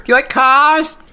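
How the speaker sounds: high, loud talk-show host caricature; Jay Leno impression. Podcaster riffing on the host's love of cars, in a high Leno impression. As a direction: push the voice way up high for a goofy celebrity takeoff. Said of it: "in a Jay Leno voice".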